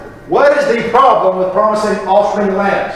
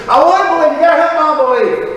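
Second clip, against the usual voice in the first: pleading believer; 6 semitones higher than usual. Retold or imitated. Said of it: "guy in the New Testament that goes to Jesus".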